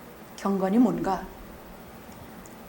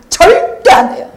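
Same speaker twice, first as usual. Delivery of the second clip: higher, louder, slow and hammered; stern shout; 8 semitones higher, 12 dB louder, slower than usual. Real, not acted. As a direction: bang it down as a rule. Preacher shouts rebuke.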